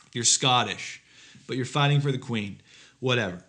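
ordinary talk

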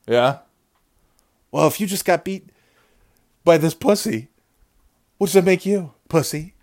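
gloating taunt. Real, not acted. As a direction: rub it in his face. Taunting the beaten rival.

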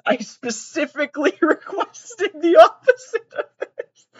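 can barely get the words out for laughing. Real, not acted. Streamer reading a snake meme caption to chat, laughing.

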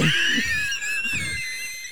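high, airy wheezing laugh; helpless laughter. Real, not acted. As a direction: crack up at your own bit. Cracking up at own bit.

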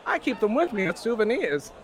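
prim gent voice. Acted. Character proudly explains teeth.

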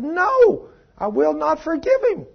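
high-pitched, defiant mock woman; imitated stubborn refusal. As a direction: refuse flatly in her high voice.

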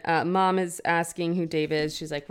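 normal talking voice

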